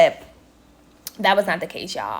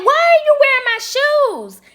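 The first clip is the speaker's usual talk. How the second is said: high, loud, outraged demand; indignant confrontation; 5 dB louder than usual. Retold or imitated. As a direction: confront her sharply, voice up high. Confronting roommate about stolen shoes.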